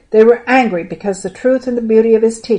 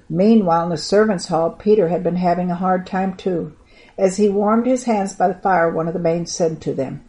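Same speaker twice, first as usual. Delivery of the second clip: ordinary delivery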